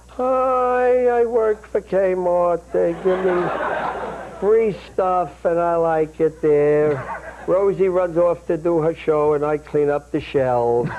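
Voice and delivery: slow, whiny put-on sister's voice; whiny Penny. Brother imitating his sister's whine, slow and drawn out. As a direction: drag the words out in a slow mock whine. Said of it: "This is a little whinier voice".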